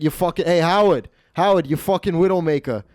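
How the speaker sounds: mock insults with dropped Rs; Stefano. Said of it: "And Stefano was like".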